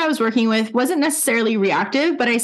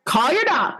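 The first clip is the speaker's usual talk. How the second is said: climbing to a high mock yell; retold shout rising in pitch; louder yell; 7 semitones higher than usual. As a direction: yell it again, higher each time. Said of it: "And then louder".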